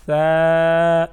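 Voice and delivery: long, loud soft ثا, held on one note. Shown as a demonstration. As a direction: draw out ثا with a soft consonant. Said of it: "نرم حرف ہے". (نرم اور لمبا ثا)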